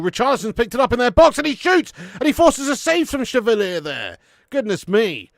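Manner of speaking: excited football commentator calling a shot; play-by-play commentary. Acted. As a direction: call it like a TV commentator, rising into the save. Mock live commentary.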